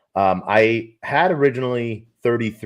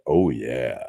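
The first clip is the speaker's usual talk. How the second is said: deep, purring husky voice; sultry voice; 10 semitones lower than usual. Shown as a demonstration. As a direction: drop low and purr it out. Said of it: "my sultry voice right now".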